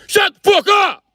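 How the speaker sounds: high, loud yell at the class; mock male teacher shout. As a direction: bark it like a furious teacher. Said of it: "It's always a male teacher coming".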